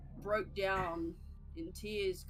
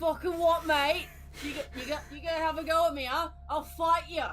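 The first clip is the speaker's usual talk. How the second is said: Aussie bogan; 7 semitones higher, 5 dB louder, faster than usual. Pretend. Mock Aussie pub brawler.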